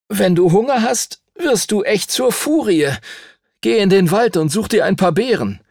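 hohe, gereizte Mädchenstimme; genervtes Mädchen. A narrator's reading. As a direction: schimpf ihn ungeduldig aus. Lucy schimpft mit Timothy. (Lucy faucht den hungrigen Timothy an, hoch und gereizt)

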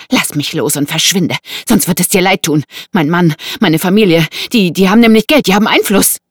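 loud, high, flustered threats; panicking woman. Acted. Woman threatens her captor with family influence, panicking. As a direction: threaten him in rising panic.

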